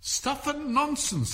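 high, indignant, drawn-out dismissal; loud scornful retort. A narrator's reading. Alice contradicting the Queen in court, loudly indignant. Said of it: "said Alice loudly".

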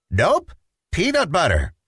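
put-on high, stubborn little boy refusal; a kid digging in his heels; sing-song stubborn child voice; stubborn Petey.

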